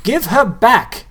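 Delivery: high, loud, slow demand; yelling. A narrator's reading. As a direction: yell at the ghouls to give her back. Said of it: "Nobody yelled".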